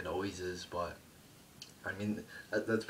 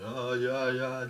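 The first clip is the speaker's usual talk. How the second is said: high, loud mock of the Usos' chant; hammed-up mock chant; mocking Usos; 5 semitones higher, 7 dB louder, slower than usual. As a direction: lampoon their silly catchphrase.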